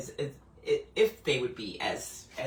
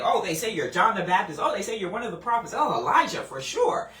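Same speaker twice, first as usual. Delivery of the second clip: louder, quick, eager listing; emphatic eager disciples; 4 semitones higher, 7 dB louder than usual. Retold or imitated. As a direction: rattle off the answers like keen students. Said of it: "they emphatically say".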